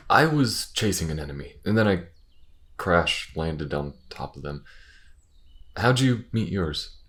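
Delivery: normal talking voice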